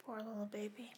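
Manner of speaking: soft whispered pity; hushed sympathy. Real, not acted. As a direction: whisper it tenderly, barely audible. Cooing sympathy for the cat.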